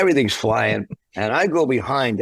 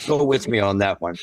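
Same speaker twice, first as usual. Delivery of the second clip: normal talking voice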